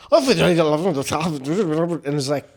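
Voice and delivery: slow, garbled Irish dialect; thick Irish mumble. Retold or imitated. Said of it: "it's just like".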